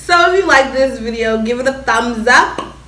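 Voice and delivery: loud, slow subscribe plea; vlog outro. Acted. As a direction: pitch the bell to viewers.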